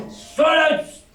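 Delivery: high, loud bellow for silence; shouted command. Acted. Pirate captain bellowing at the whole inn for silence. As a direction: roar it to shut the room up.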